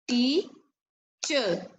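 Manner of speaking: "Teacher" split into two beats with a long pause between; syllable by syllable. Shown as a demonstration. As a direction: say "Teacher" as tea, pause, cher, for clapping. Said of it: "As I'm saying the word, you clap the beat".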